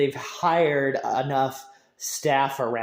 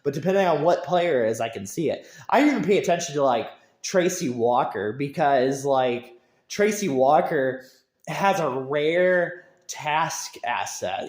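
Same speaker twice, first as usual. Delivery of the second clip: ordinary talk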